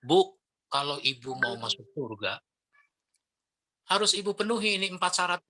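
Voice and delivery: a touch of talking down to the listener; lecturing. Acted. Trainer demonstrating how not to preach to mothers, lecturing. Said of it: "jangan terlalu menggurui".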